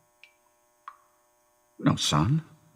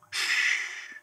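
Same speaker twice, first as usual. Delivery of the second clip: soft soothing shush; long, breath-only hush; hushing shh; 8 dB quieter than usual. Acted. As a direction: hush the upset son softly. Father hushes crying son.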